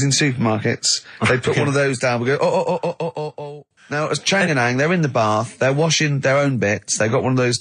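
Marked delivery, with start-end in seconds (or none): none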